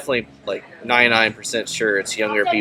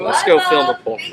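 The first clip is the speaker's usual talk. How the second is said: much higher mock-eager voice; mock eager character. Friend mocks the movie characters' plan to film, mock eager. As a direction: pitch it way up, playing a keen filmmaker. Mocking the film's plot.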